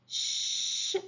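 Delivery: "ch" as a long voiceless shhh; isolated ch sound. Shown as a demonstration. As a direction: hold "ch" softly, with no vowel. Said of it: "On garde". (« ch » soufflé et prolongé, sans voyelle)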